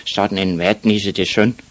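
ordinary delivery

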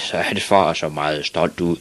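ordinary delivery, nothing marked

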